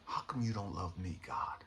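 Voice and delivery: hushed heartbroken plea to God; broken whisper. Retold or imitated. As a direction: let the anger collapse into hurt. Broken plea after the rage.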